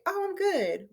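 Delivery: high, lilting, upbeat "I'm good". Shown as a demonstration. Teacher showing how tone of voice reveals feeling, upbeat. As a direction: say it brightly, pitched well up. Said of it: "They can tell by the tone of your voice".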